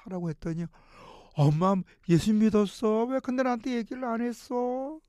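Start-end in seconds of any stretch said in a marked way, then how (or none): 1.3-5.0 louder, higher, startled question to mother; surprised outburst. Retold or imitated. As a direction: blurt it out in disbelief. Son learns mother believes.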